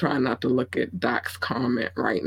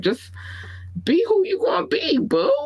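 Brazilian woman voice. Pretend. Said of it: "did you just say that I'm doing the Brazilian woman?".

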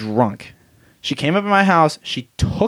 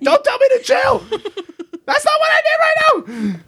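mom voice; faster than usual. Retold or imitated. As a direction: scold like an outraged mom. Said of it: "I become mom".

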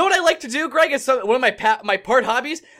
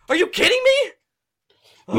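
exasperated; 9 semitones higher, much slower than usual. Real, not acted. Exasperated rant about the coach.

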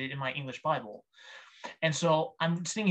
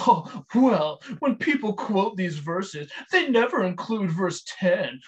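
mocking a smug critic, louder and slower; slow, loud, mock-superior "Oh, well"; haughty, arrogant; 3 semitones higher, 4 dB louder, slower than usual. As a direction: sneer it like a know-it-all correcting you. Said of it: "in a very kind of haughty, arrogant kind of attitude".

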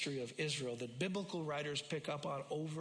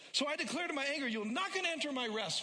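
fervent preaching; 10 semitones higher than usual. Acted. Pastor voicing God's warning to the congregation, impassioned. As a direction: cry out God's warning to the church.

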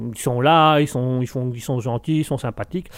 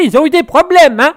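much higher, louder put-on threat; mock menacing voice; 11 semitones higher, 7 dB louder than usual. Streamer jokingly threatens chat with a hammed-up villain voice.

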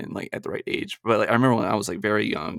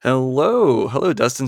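signature hello; 4 semitones higher, 5 dB louder than usual. Real, not acted. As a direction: stretch the hello like a trademark greeting. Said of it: "I do love how every time you say, "Hello".

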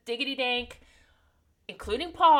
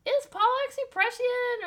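mock surprise; 12 semitones higher, 3 dB louder than usual. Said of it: "great moment, Jessica's like".

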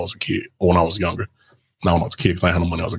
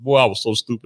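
normal talking voice

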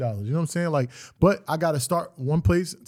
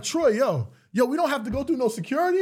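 excited; 9 semitones higher than usual. Retold or imitated. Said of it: "I'm like".

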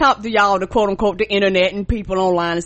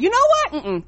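much higher squeaky mock voice; mock sassy reply; 11 semitones higher, faster than usual. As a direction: brush them off teasingly. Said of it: "I be like".

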